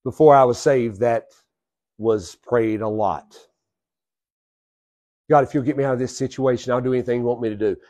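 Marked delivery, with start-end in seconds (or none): 5.3-7.8 1 rushed mock prayer.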